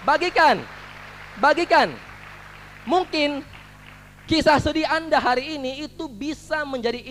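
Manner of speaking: loud, high-pitched rally to the crowd; building, shouted call to share stories; rousing motivational speech. Acted. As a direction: whip the audience up like a motivational speaker. Motivational speaker rallying audience.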